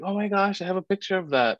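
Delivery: high, bright burst of delight; light up like you just remembered a treasure; excited exclamation. Retold or imitated. Excitedly showing daughter a photo.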